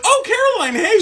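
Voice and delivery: high-pitched, gushing hello; mock cheery greeting. Roommate greeting his friend's girlfriend by mistake, mock cheery. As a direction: greet her in an exaggeratedly high, oblivious voice.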